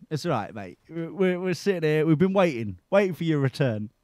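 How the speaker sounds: ordinary delivery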